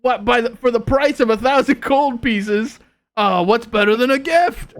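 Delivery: high, loud, thrilled voice; delighted patron. Acted. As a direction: gush over the coupon like a happy fool. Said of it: "they say".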